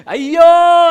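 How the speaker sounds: very high, full-volume cry; loud wail. Acted. Joseph breaking down before his brothers, wailing loudly. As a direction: wail it out so the whole house hears. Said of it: "అని చెప్పి గట్టిగా అరిచిండంట".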